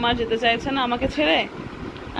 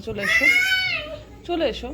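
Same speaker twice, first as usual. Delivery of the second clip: calling out. Real, not acted. Calling child to come outside.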